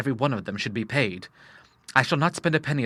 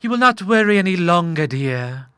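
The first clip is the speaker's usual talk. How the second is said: lightly voiced, higher maternal tone; murmuring mother; 7 semitones higher, 6 dB louder than usual. A narrator's reading. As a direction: soothe your son in a higher voice. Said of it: "murmured his mother".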